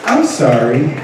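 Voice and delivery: low, unhurried, cool comeback; composed mock apology. Student council member answers a hater, low and composed. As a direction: answer the hater softly with measured poise. Models calm comeback to rant.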